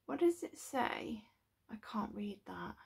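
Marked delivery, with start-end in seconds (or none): 1.7-2.8 whispering. Real, not acted. Squinting at tiny print.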